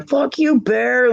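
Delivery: mock grumble. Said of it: "other room going".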